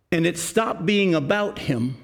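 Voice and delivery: ordinary delivery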